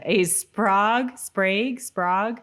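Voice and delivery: Sprague tried out slowly, once as spɹɑɡ, then spɹeɪɡ, then spɹɑɡ again, each ending on a rise; fumbling a name. Real, not acted. Co-host reading goofs aloud stumbles over Sprague, halting and unsure. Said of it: "Why am I keep getting these ones?".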